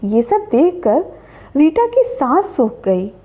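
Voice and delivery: ordinary delivery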